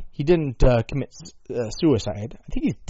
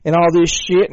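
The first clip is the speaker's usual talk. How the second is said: a lightly put-on Southern accent; bragging yokel in a Southern accent; mock Southern accent; 4 semitones higher, 7 dB louder than usual. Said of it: "the stereotypical voice from the South".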